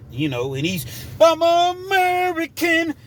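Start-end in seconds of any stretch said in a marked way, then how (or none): 1.2-2.9 slow, high, loud wrestler bellow; Hulk Hogan. Retold or imitated. Big Will swelling up at whoever opened the door, drawn-out wrestler shout. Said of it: "he goes through his Hulk Hogan".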